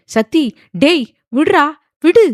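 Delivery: high, loud, urgent shouts; frightened. A narrator's reading. Brother stops man choking his sister, frightened shouting. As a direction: shout in alarm to make him let go. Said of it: "Rana பயந்து போய்".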